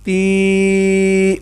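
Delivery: تی drawn out as a long steady teeee. Shown as a demonstration. Teacher stretches the vowel so the pupil hears it. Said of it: "تا زیر یا".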